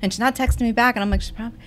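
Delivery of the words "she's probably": worried undertone. Retold or imitated. Retelling her own worried thought.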